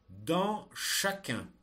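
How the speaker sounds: slow, loud and distinct dictation; dictating. Shown as a demonstration. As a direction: read each word out for pupils to copy. Teacher dictating text to pupils.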